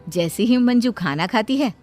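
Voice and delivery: ordinary delivery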